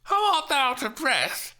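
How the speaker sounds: high, loud, indignant; demanding Juliet. Acted. Juliet challenging the breathless nurse, high and loud. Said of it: "Steph demanded".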